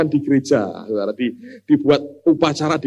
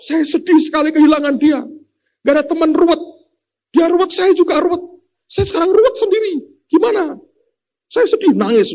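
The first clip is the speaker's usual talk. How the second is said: much higher, whiny mock-grieving voice; careless mourner; 12 semitones higher than usual. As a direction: squeal out a careless friend's fake sorrow. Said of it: "Ngomongnya seenaknya".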